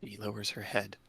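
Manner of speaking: ordinary talk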